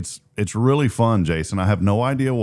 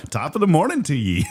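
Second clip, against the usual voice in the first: mock Irish; 7 semitones higher than usual. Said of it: "Ireland greetings".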